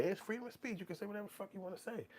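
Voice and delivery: high, soft, fast dismissive voice; mock free-speech defender. Voicing people who defend free speech, high and airy. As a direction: toss it off lightly in a high voice.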